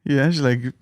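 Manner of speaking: ordinary talk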